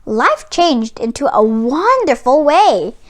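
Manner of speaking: usual speaking voice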